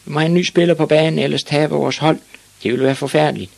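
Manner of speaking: high, urgent crowd plea; shouting. Acted. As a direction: call out urgently for a substitute. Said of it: "råbte de".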